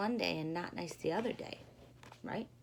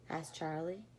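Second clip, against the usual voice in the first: ordinary delivery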